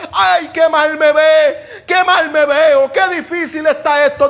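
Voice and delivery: slow, drawn-out mock whine of "Ay, qué mal me veo"; mock self-pity. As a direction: parody someone wallowing in their troubles. Mocks self-pity in suffering. (lamento burlón y exagerado de autocompasión)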